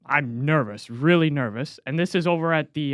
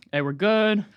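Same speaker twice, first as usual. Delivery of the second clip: normal talking voice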